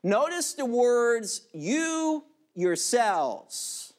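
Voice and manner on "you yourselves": slow, weighty "you yourselves"; emphatic you yourselves. Shown as a demonstration. Preacher stressing "you yourselves" to the congregation, slow. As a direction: lean hard on "you yourselves". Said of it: "It's emphatic".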